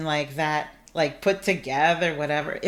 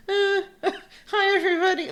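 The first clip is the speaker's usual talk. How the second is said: much higher, laughing through tears; awkward frozen-smile greeting; 13 semitones higher than usual. Retold or imitated. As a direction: squeak it out with a stuck grin, half laughing, half crying. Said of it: "I had this frozen smile".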